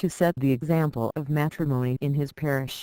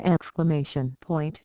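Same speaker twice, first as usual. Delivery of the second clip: ordinary delivery, nothing marked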